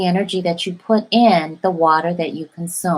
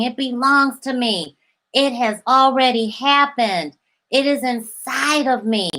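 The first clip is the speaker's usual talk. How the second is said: slightly higher, savoring each claim; manifesting affirmations; 4 semitones higher than usual. Shown as a demonstration. Coach voicing affirmations to viewers, slightly higher and savoring. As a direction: chant it as if already yours.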